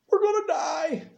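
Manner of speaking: high, crying shout of panic; panicked cry. Acted. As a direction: wail it like a terrified servant. Servant's panic paraphrased.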